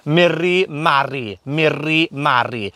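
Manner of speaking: slow "Miri mari" with trilled r's; rolled R's. Shown as a demonstration. Welsh teacher modelling nasturtium for learners, slow rolled r's in "Miri mari". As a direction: roll each r in "Miri mari", taking it slowly. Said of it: "we roll our R's".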